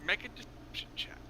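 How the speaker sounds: unvoiced whisper, soft. Real, not acted. Game master whispers a call for a deception check, whispered. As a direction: whisper it under your breath.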